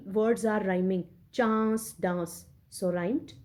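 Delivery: ordinary delivery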